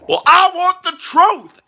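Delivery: high, loud mock courtroom demand; dramatic movie confrontation. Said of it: "and he said".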